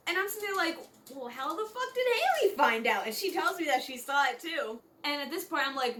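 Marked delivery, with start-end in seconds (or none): 1.1-3.1 louder, higher mock outrage; indignant disbelief. Girl hearing Haley is telling people, indignant outburst. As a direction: blurt it out, scandalised. Indignant reaction to the gossip news.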